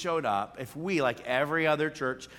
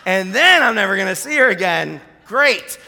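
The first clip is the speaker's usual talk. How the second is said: louder, higher mock dread; dreading inner voice; 5 semitones higher, 12 dB louder than usual. Pastor voicing his panic as Sandy approaches, sarcastic dread. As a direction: brace for the telling-off. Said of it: "and I'm like".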